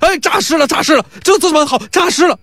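high, panicked yelling; panicked cries. Acted. Bystanders see the coffin open and cry out in terror. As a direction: cry out as if the dead just sat up. Crowd sees corpse rise.

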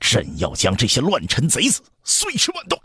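furious vow, rising high at the end; enraged emperor's roar. Acted. Emperor vows to punish the assassins, roaring in rage. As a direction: build in rage to a high final cry. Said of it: "刘启盛怒到了极点".

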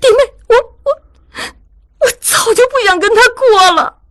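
high-pitched rant building into sobbing; from aggrieved complaint climb to a tearful wail; indignant. A narrator's reading. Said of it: "如玉气愤地说".

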